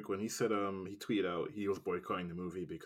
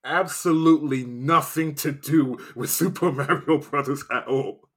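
louder, higher, slowed emphatic rant; heated rant; 6 semitones higher, 11 dB louder, slower than usual. Real, not acted. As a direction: hammer home how bad it was. Ranting about the bad movie.

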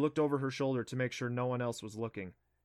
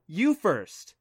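higher, louder insistent retort; 6 semitones higher, 9 dB louder than usual. Acted. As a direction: insist right back. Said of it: "she insisted".

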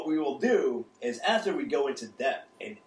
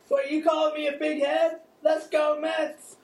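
put-on high, loud drunk Mister Met; loud drunk heckling; 13 semitones higher, 5 dB louder than usual. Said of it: "drunk guy at the bar".

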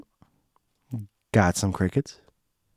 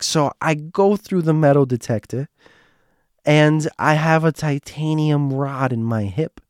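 Joanne; 5 semitones higher than usual. Retold or imitated. Joanne explains the metal detector, in mock earnest. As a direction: exaggerate each word in a higher put-on voice. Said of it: "this is my impression of her".

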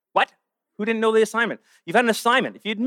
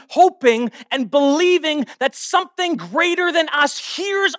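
stretched phrases climbing high in pitch; impassioned preaching; 7 semitones higher than usual. Real, not acted. Preacher on the boldness of praying, impassioned preaching. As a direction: build slowly to a high-pitched, incredulous peak.

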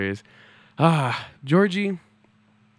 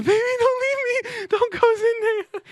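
far higher, hammed-up wailing fan; desperate fan plea. Fan outside Euphoria High pleading with Zendaya, far higher hammed-up wail. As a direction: beg like a heartbroken superfan.

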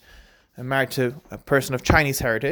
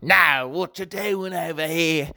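drawn-out mock Australian accent, slow; lightly put-on Australian accent for a rude customer; 4 semitones higher, slower than usual. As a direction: sneer it like a grumpy Australian customer. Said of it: "I go to Australian accent for my mean customers".

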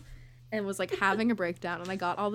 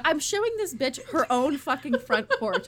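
ordinary talk, nothing marked

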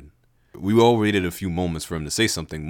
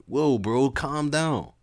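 higher, easygoing bro voice; chiding bro; 7 semitones higher, slower than usual. Acted. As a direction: soothe him like a chill friend. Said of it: "Lev chided".

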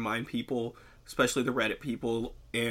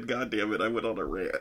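normal talking voice

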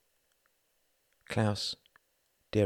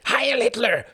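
loud, high rallying cry; shouted salute; 9 dB louder than usual. A narrator's reading. The Count jumps up and clicks heels, shouted salute. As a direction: bellow the salute at high pitch.

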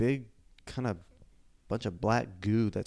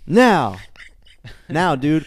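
loud demand; 3 semitones higher, 10 dB louder, much slower than usual. Acted. Acted Pokemon rival showdown.

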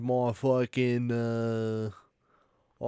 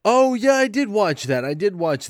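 excited; 11 semitones higher, 8 dB louder than usual. Real, not acted. Sudden loud exclamation.

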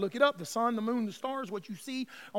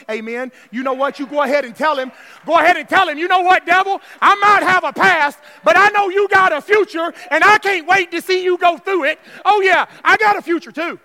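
loud, raised, defiant preaching; full-force preaching; 8 semitones higher, 17 dB louder than usual. Real, not acted. Preacher showing the church how to tell the devil off, defiant shouting.

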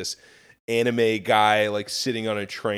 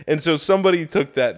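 normal talking voice

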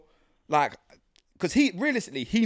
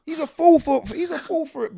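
exasperated, high-pitched; 12 semitones higher than usual. Real, not acted. Exasperated about Andrew.

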